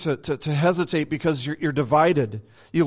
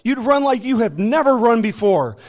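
normal talking voice

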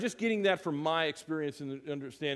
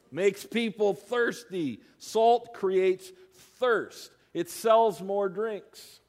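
slow, loud, higher punchline; preaching emphasis; 6 semitones higher, 7 dB louder, much slower than usual. Acted. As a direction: hammer the point home to the congregation.

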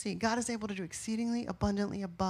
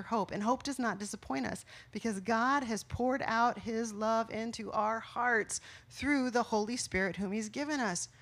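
normal talking voice

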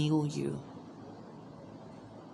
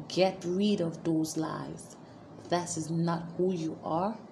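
ordinary talk, nothing marked

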